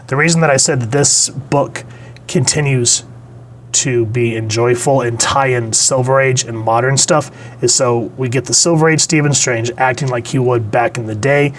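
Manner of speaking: ordinary talk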